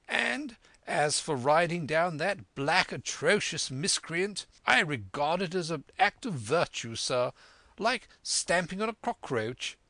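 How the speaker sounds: high-pitched, effusive squire; hearty excitable squire. Acted. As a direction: heap on praise in a high, pompous voice. Voices the squire praising a guest.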